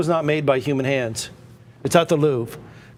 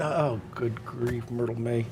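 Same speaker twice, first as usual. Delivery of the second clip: slow, much softer, worn-out sigh; exasperated sigh; 6 semitones lower, 9 dB quieter than usual. Acted. A mother worn out by her daughter Myrtle May, sighing softly.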